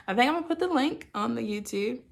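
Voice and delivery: normal talking voice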